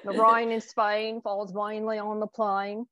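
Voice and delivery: put-on slow, lightly posh British accent; a hint of My Fair Lady British, drawn out slowly; Eliza Doolittle line. Guest tries the Eliza Doolittle line in British accent. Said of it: "You wanna do Eliza Doolittle?".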